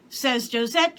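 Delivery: usual speaking voice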